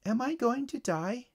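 put-on high, small child's voice; worried little girl. A narrator's reading. Bitten girl asks the paramedic about dying, high small voice.

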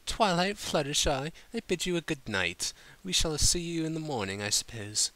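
high, refined farewell; lightly voiced prim lady. A narrator's reading. Rarity saying goodnight to Twilight and Fluttershy, lightly prim. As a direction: bid them goodnight graciously.

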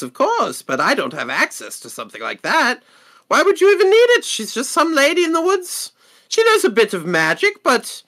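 indignant shopkeeper with swooping pitch; huffy merchant correcting a customer; defensive merchant. Acted. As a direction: jump high in protest, then settle into dismissal.

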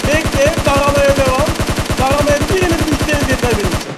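shouted orders over the gunfire; loud, urgent battle call; loud callout. Real, not acted. Calling orders mid firefight.

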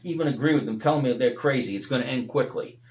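fervent preacher, rising and falling; preaching. Acted. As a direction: preach it out to the congregation. Preaching at full force.

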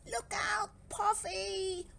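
high, stretched warning call; urgent warning. A narrator's reading. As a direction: call out in alarm to a friend. Barry warns Puffy of crate.

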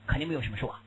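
ordinary talk, nothing marked

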